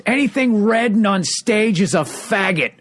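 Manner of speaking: high, slow mock heckle shout; drunk heckler yell. Drunk heckler in the corner yelling at the comic. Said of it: "the guy yells out".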